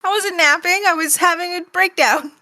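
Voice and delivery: high-pitched, put-out objection; indignant protest. Acted. Steven objecting to Garnet about the nap, indignant. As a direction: protest as a wronged, overwrought kid.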